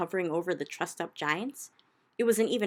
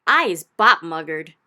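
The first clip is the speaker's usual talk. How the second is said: slow, much louder giant screech; 14 dB louder, slower than usual. Acted. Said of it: "screeched the butcher boy".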